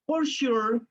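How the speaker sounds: ordinary talk, nothing marked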